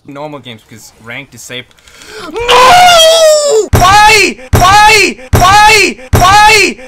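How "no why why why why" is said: high, loud wailing shriek; anguished shriek. Real, not acted. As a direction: wail in despair at the game. Shrieking at being chain-stunned.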